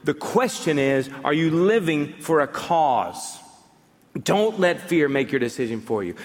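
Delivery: ordinary delivery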